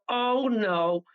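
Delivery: half-laughing mock alarm; retold alarm. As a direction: play the dawning horror of a coming blast. Retold realization gasoline will explode.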